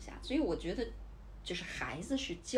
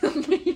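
laughing; 10 semitones higher, 12 dB louder than usual. Real, not acted. Laughing at friend's remark.

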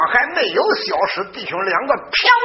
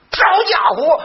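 angry shout; 8 semitones higher, 3 dB louder, slower than usual. Acted. Yang Yuan strikes in anger.

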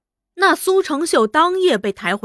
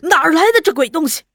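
fast, louder, higher burst of shock; startled question; 4 semitones higher, 6 dB louder, much faster than usual. A narrator's reading. Frightened husband sees severed ears, startled outburst. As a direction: blurt it out in alarm. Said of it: "吃惊地问".

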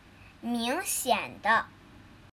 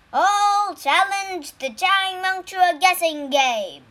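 tiger immortal voice; 6 semitones higher, 7 dB louder than usual. Acted.

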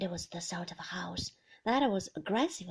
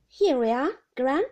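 put-on calling loudly to a deaf old grandmother; loud and clear so Gran can hear; raised voice; 4 semitones higher, 10 dB louder than usual. Beatrice calls to her deaf grandmother, loudly. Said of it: "she said, raising her voice".

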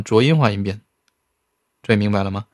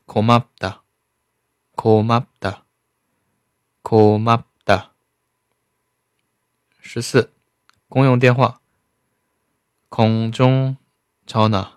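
usual speaking voice

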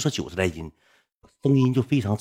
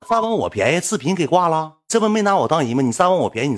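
indignant; 7 semitones higher, 8 dB louder than usual. Real, not acted. Indignant at being used.

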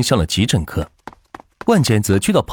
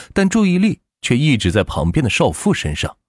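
ordinary delivery, nothing marked

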